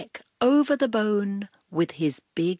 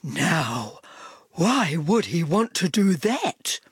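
Dog's moaning voice; 3 semitones lower than usual. A narrator's reading. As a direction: moan it out as the grumpy dog. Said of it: "Dog dropped the bone".

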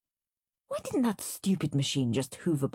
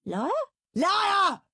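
accusing; 3 dB louder than usual. A narrator's reading. Brothers shouting in quarrel.

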